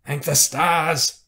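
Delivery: loud, high, drawn-out relief; loudly thanking heaven, slowly; relieved exclamation. Acted. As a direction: draw it out with heartfelt relief. Soldier hears of recall, relieved.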